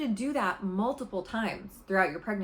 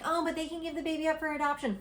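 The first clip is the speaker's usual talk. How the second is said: higher, quicker mocking objection; mock protest, pitched up and rushed; up in arms; 7 semitones higher, faster than usual. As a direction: mimic an indignant objector in a raised, hurried voice. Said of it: "get all up in arms".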